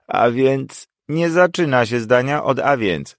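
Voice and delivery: stern correction. Acted. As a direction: scold like a strict professor. Professor correcting student sharply.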